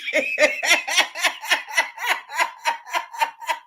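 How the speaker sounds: wheezing laugh; long giggle. Real, not acted. Laughing again at the lady's insult, telling viewers. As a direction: giggle until breathless.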